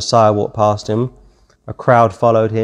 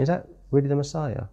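quick, hushed, higher-pitched question; gossiping doubt; 4 semitones higher, 10 dB quieter, faster than usual. Retold or imitated. Preacher imitates Judas turning around to gossip, hushed doubt. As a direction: slip it in softly like gossip.

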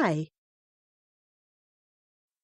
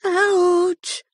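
a sharp, louder, higher yelp; loud high ouch; 11 semitones higher, 5 dB louder than usual. A narrator's reading. Tree creature yelps at the leaning hare. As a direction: cry out as if leaned on. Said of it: "said a voice".

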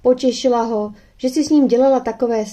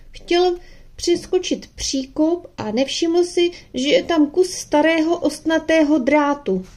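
put-on higher, upset girl explaining; lightly voiced young girl; 8 semitones higher than usual. A narrator's reading. Girl tells her mother how the dog got hurt, lightly higher, anxious. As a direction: tell mum anxiously what happened.

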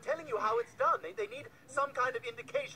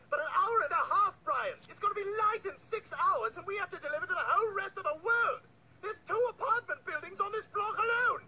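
exasperated rant; 9 semitones higher than usual. Acted. Ranting at partner about delivering worldwide, exasperated outburst. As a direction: rail at the impossible workload.

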